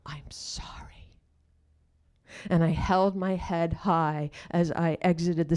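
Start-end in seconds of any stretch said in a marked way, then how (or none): 0.1-0.8 barely audible whisper; mouthed apology. Retold or imitated. Actress to her scene partner after the scene, whispered apology. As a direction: mouth it with hardly any sound, just breath. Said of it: "I mouthed to my scene partner".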